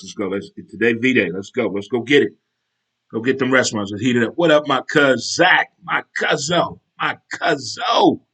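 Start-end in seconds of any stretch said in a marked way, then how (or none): 4.3-8.2 slow, drawn-out higher holler; loud, stretched greeting hollered to family; hyped shout-out. Real, not acted. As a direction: call out to a favorite cousin, stretching it. Shout-out to his cousin Zach.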